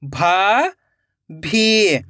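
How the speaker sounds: भाभी split into भा, long pause, भी; rising भा, then falling भी; syllable by syllable. Shown as a demonstration. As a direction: sound out भाभी very slowly in two parts. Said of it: "एक एक अक्षर का उच्चारण करना है".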